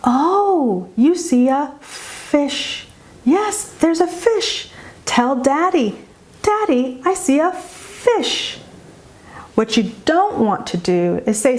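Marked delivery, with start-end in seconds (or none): 0.0-8.6 high, slow sing-song, highlighting "fish"; delighted child-directed voice; nice encouraging parent. Shown as a demonstration. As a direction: model "fish" for a child, pitched up and drawn out after a slight pause. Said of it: "that parent could quite nicely say".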